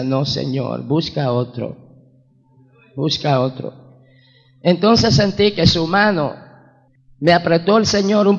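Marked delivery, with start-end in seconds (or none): 0.0-3.7 low, soft, understated plea; retold tearful pleading. Begging the Lord to send someone else, subdued tearful pleading. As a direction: beg off quietly, only a touch tearful. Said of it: "Y yo llorando, llorando, hermanos, le decía".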